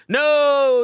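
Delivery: loud, high burst of no; sharp excited no; sudden no. Real, not acted. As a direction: blurt it out as you spot it. Finds the message suddenly.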